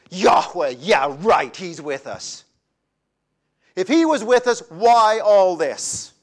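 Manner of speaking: rising fury, throwing God's name back; indignant, angry outburst. Acted. Gideon to the angel, indignant and angry. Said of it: "out of indignation, anger, and frustration".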